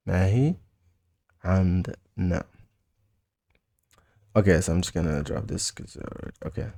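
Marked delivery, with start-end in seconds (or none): 0.1-2.4 "ما هي", long pause, then "عندنا" in syllables; word by word. Shown as a demonstration. Teacher modelling we don't have it, word by word. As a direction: separate and stretch "ما هي عندنا" for learners.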